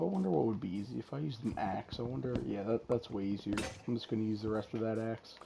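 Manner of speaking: usual speaking voice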